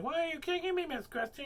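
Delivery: high whiny mock complaint; hammed-up high-pitched protest. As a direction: squeak it out like a wronged kid. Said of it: "I'm like".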